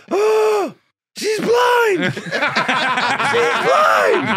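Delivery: very high, loud gasping squeal; hysterical disbelief. Retold or imitated. Fellow comedian curled up on the floor, imitated hysterical squealing. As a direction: squeal it as if helpless with laughter. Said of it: "he can't stop laughing".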